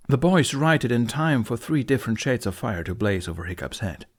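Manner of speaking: ordinary delivery, nothing marked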